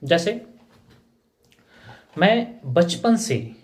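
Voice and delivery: ordinary talk, nothing marked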